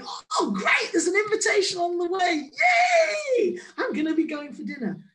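a hammed-up cheer over a dinner invite; mock excited. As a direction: overplay the thrilled guest. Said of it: "others may think".

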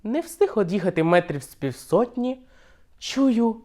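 ordinary delivery

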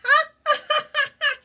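very high, loud squeaky giggling; high little laughing friends; critter giggles. Acted. Friends outside laughing loudly, very high giggles.

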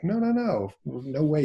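high, quick-fire protest; emphatic refusal. Retold or imitated. Husband rejecting his wife's suggestion, high-pitched mock-appalled protest.

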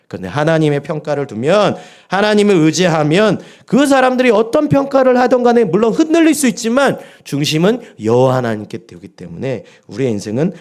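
rising fervent preacher's cry; preaching at full force. Acted. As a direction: hammer the warning home to the congregation. Preaching climax.

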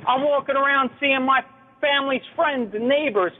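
ordinary talk

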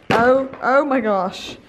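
ordinary delivery

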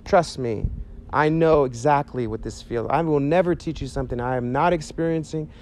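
usual speaking voice

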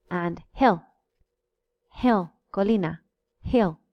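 hill with a short ɪ. Shown as a demonstration. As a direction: clip the i in hill. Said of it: "You see the difference?".

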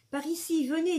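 sudden call. A narrator's reading. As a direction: call them over urgently from the back of the nave. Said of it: "dit une voix tout à coup".

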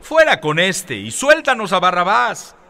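high, loud cry of an angry mob; loud crowd shout calling for Barrabas. A narrator's reading. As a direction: shout it as the whole crowd demanding. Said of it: "dio voces a una".

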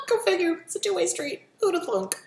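high, laughing go-figure sarcasm; a sarcastic who'da-thunk with a laugh; sarcastic laughing quip. Real, not acted. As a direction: laugh at the irony, mock surprise. Sarcastic aside on Bible verse.

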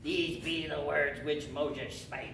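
slow, slurred pirate drawl. Shown as a demonstration. Teacher showing why the verse sounds piratical, pirate voice. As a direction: recite scripture as a pirate, stretching each word. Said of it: "it just sounds like a pirate is saying this".

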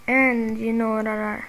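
ordinary talk

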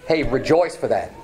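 usual speaking voice

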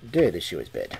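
usual speaking voice

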